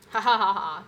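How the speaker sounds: loud, punched-out "ha ha ha ha"; triumphant laugh. Real, not acted. Streamer celebrating getting points, triumphant laugh. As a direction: crow with a big, loud laugh.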